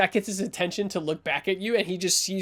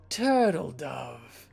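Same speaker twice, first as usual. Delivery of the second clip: slow, low pet name; low-voiced tough guy addressing a friend; intimidating protector; 4 semitones lower, much slower than usual. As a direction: draw it out slowly and low. Cobra turns to address his friend.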